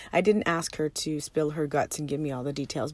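ordinary delivery

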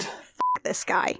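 slow, hushed curse; breath-only swearing in disgust; whispered disgust. Acted. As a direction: whisper it with drawn-out contempt. Disgusted reaction to Wilbur's complaint.

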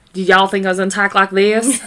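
put-on Southern accent, playful; mock Southern talk. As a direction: imitate an exaggerated Southern twang. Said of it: "I do love Southern accents".

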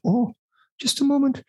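high, badly faked Scottish old lady; a wobbly mock Scottish shop lady; terrible Scottish accent. Shop lady fawning over the baby, in a hammed-up Scottish accent. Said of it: "channeling of Missus Doubtfire".

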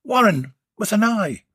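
high, chirpy name spelled out; chirpy tradesman giving his name; chirped. Acted. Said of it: "he chirped".

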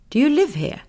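a lightly voiced small girl's question; curious girl. Belle asks the creatures.

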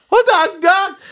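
high, loud laughing outburst; laughing disbelief. Real, not acted. Comedian cracks up at his own story, laughing loudly.

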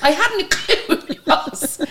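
high, slow laughing squeal; laughing confession. Real, not acted. Singer had no clue it was [name], laughing helplessly. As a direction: drag it out through helpless laughter. Laughing at her own blunder.

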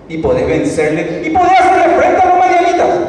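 voz mucho más alta, exaltada; clímax de predicación. Acted. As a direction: lanza la promesa hacia arriba. (predicador exaltado al cerrar la promesa)

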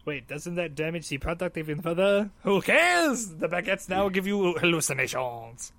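hammed-up French mobster; French accent. French mobster selling spoiled drug bread, mock French accent. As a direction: question and dismiss it in mock French. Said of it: "it's the French mafia".

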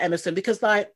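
ordinary delivery

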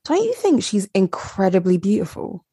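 ordinary talk, nothing marked

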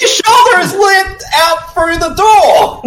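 high, drawn-out outraged yell; incredulous shout. Real, not acted. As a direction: point out the absurdity at a high shout. Outraged at bad hiding scene.

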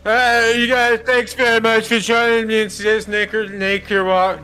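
put-on a boozy old counselor greeting the kids; crazy drunk. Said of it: "Blade, you are the crazy drunk".

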